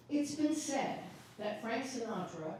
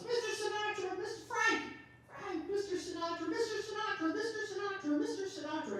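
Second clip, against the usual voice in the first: mock yelling fan; 9 semitones higher, faster than usual. Fan outside the hotel calling after [name], high mock yell. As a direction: imitate a fan shrieking his name over and over. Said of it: "yelling at the top of her lungs".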